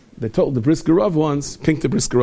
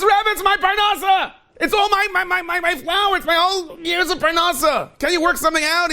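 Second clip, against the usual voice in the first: much higher, crying-out plea; desperate pleading. Acted. Mill owner begging the Rav to save his livelihood, high desperate plea. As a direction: beg the Rebbe with all you have.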